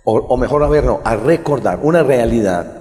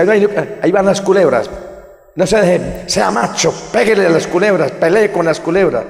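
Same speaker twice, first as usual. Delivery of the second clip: higher, louder mocking father goading the boy; taunting father; 6 semitones higher, 4 dB louder than usual. Priest voicing the father goading his son to fight the snakes, loud and mocking. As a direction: jeer at the child to fight the snakes. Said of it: "Porque le decía".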